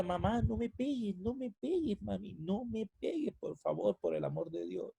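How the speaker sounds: high, pleading, mock-tearful begging; retold crying plea. Son retelling begging his mother not to hit him, high tearful plea. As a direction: beg your mother, with a touch of tears. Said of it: "yo lloraba y le decía".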